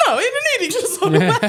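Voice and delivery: high loud words breaking into laughter; laughing mock yell. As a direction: shout it while cracking up. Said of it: "He goes".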